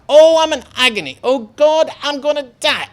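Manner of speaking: high, loud melodramatic wailing; mock anguish for the teacher; hammed-up agony. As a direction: overact dying of need. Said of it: "Hold on, I'm only acting".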